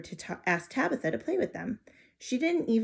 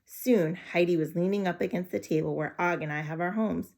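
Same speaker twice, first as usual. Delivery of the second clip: plain reading voice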